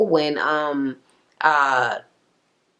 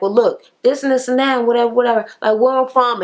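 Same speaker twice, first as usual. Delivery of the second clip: higher-pitched retelling of a heated dude; mock argumentative; 7 semitones higher, 4 dB louder, faster than usual. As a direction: act out his challenge, raised pitch. Acting out an argument at dinner.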